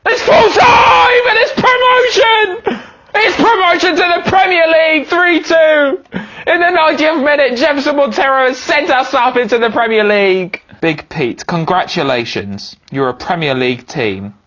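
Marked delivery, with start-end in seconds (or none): 0.1-9.7 much higher, screaming commentary; ecstatic goal shout. Real, not acted. Manager celebrating the late promotion winner, screaming with joy. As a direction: lose it completely over the winner.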